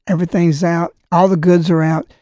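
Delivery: ordinary talk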